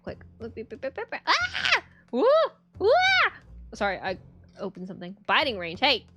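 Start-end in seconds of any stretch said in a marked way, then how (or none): 0.4-1.8 startled shriek. Real, not acted. Startled by something opened.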